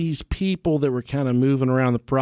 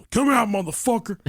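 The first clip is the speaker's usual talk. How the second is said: higher, daring call out; mock tough challenge; 8 semitones higher than usual. Father daring the ghost to show itself, mock tough call. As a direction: taunt the ghost like a tough guy.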